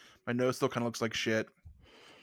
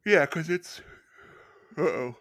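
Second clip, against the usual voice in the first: talking through a yawn. Real, not acted. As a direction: yawn while saying it. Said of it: "I'm yawning on".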